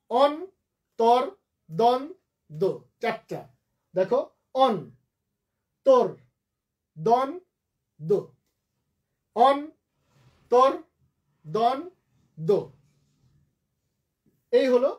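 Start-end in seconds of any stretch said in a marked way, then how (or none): 0.1-2.7 অন, তর, দন, দো spoken as four separate beats; syllable by syllable. Shown as a demonstration. Teacher splitting a word for students, syllable by syllable. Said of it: "syllable যদি ভাঙে". 4.5-12.6 অন, তর, দন, দো with a long pause after each; syllable by syllable. Shown as a demonstration. Teacher repeating the split word to students, syllable by syllable. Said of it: "syllable যদি ভাঙে".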